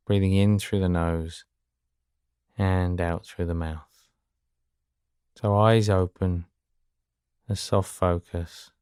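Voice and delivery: very soft, slow meditation voice; guided meditation. Acted. Teacher guiding listeners to gently focus their eyes, soft guided meditation. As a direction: leave long pauses, speak low and hushed.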